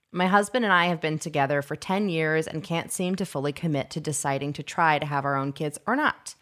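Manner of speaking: steady letter reading voice. Acted. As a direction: read the letter out calmly. Reading listener letter aloud.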